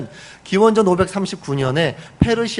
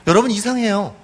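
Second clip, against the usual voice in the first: usual speaking voice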